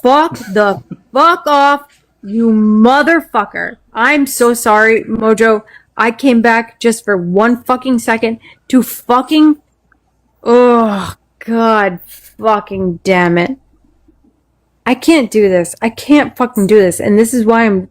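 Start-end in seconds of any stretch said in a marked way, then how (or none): 0.0-3.8 angry cursing. Real, not acted. Streamer cursing at whoever told her to calm down, slow and angry. 10.4-13.6 slow groan of frustration; exasperated cursing. Real, not acted. Streamer breaking off her rant, slow and exasperated.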